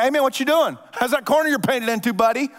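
high mocking jeer; mocking taunters. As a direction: taunt him like a smug bully.

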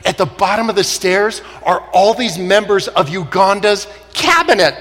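ordinary delivery

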